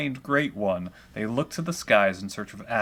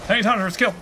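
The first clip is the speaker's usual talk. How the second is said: loud, high triumphant call; excited cheer; 9 semitones higher, 6 dB louder, much faster than usual. Real, not acted. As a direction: cheer the win again. Repeats the cheer.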